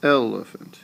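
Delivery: Elephant starting strong on EL and falling; EL-e-phant, dropping over the last two syllables; voice going down. Shown as a demonstration. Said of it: "your voice is going down".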